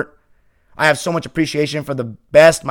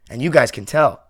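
ordinary talk, nothing marked

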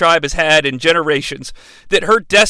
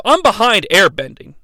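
ordinary delivery

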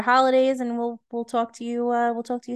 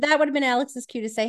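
ordinary talk, nothing marked

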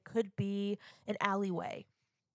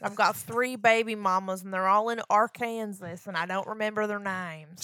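put-on louder backwoods Florida accent, like Ruthie from Ozark; 5 dB louder than usual. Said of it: "You sound like Ruthie from Ozark".

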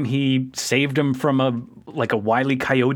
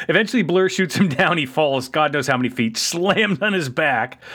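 ordinary delivery

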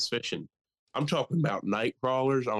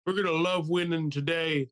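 mock presidential speech; 6 semitones higher than usual. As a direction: imitate a doddering politician rallying fans. Mock presidential Super Bowl speech.

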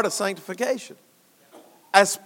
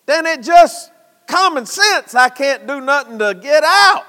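loud, higher-pitched preaching cadence; preacher hammering home that the saved cannot be lost; 5 semitones higher, 5 dB louder than usual. Acted. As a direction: preach it out to the church. Preacher building to a climax.

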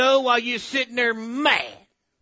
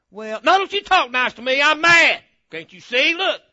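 hammed-up loud, high indignation; mock-angry, rebuffing any kindness; mad; 6 semitones higher, 10 dB louder than usual. Preacher playing the grudge-holder to the congregation, mock mad. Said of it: "put your mad face on".

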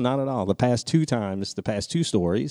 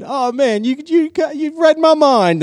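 very high, loud imitated excitement; hammed-up glee, pitched way up; mock delighted reply; 7 dB louder, slower than usual. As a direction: crow it like a thrilled DJ. Acts out the DJ's eager reply.

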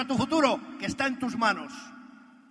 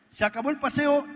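louder and higher, with firm finality on "paseo"; emphatic repeat; 5 semitones higher, 5 dB louder than usual. Real, not acted. Telling the audience he dropped the afternoon walks, a louder, firm repeat.

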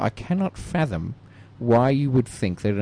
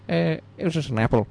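ordinary delivery

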